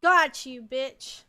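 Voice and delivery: real victory shout; triumphant yell. Real, not acted. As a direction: yell it as you finally win. Landing the killing blow.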